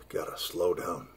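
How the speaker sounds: worn-out murmur between hiccups; hiccuping. Real, not acted. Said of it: "hiccups, which I have right now".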